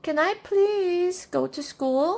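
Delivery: put-on high-pitched child's plea; begging. A narrator's reading. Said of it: "she begged".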